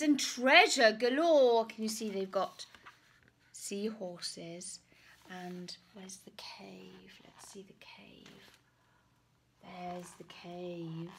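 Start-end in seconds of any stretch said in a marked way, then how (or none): 5.3-11.1 secretive whisper to little listeners. Real, not acted. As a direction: lean in and whisper. Whispering aside to children.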